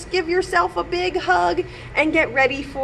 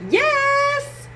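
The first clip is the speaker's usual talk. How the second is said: put-on high little kid's yes; child's voice; 11 semitones higher than usual. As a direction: answer like a small boy. Child's answer.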